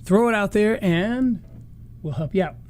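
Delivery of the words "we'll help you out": usual speaking voice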